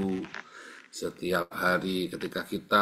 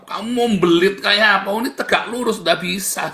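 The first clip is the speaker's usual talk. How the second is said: fired-up challenge; 10 semitones higher, 9 dB louder than usual. Real, not acted. Preacher challenging schemers, fired up.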